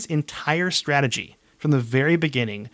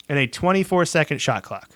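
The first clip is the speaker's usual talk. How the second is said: ordinary talk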